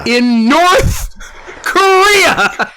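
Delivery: high, loud laughing yell; laughing shout. Real, not acted. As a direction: shout it back, cracking up. Excited shouted echo with laugh.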